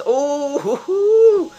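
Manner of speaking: very high, loud hooting; real falsetto hoot of joy; delighted whoop. Real, not acted. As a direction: whoop in rising glee. Whoops at the game.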